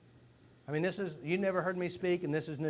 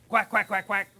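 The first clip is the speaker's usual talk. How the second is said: loud, high mock duck quacking; loud duck quacks; 4 semitones higher, 10 dB louder than usual. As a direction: blurt out the quacks like a hypnotized duck. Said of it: "you'll quack like a duck".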